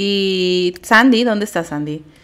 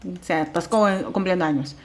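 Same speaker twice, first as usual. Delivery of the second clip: normal talking voice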